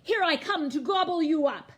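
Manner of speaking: loud, high, fast charge; bellowing troll. A narrator's reading. Troll charging at the biggest goat, bellowing. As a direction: bellow it as you leap up.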